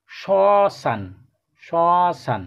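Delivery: শ্মশান said as শশান, silent ম; pronunciation. Shown as a demonstration. Teacher modelling the word for pupils, clearly. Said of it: "মোটাকে বাদ দিয়ে যেভাবে উচ্চারণ করা যায়".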